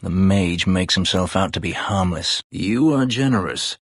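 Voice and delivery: ordinary talk, nothing marked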